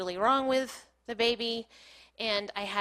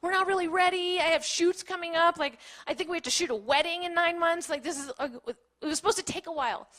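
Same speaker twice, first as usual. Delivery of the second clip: high-pitched panicky protest; retold mild panic; freaking out; 7 semitones higher than usual. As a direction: pile up worries in a raised, higher voice. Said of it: "I started freaking out".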